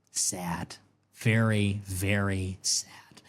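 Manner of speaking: mock sadness. Mock Trump catchphrase, sad.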